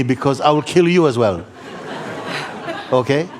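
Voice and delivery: loud, high, laughing teasing; a big playful joke to the room. Real, not acted. Teacher joking loudly to his listeners through laughter. Joking that he almost killed guru.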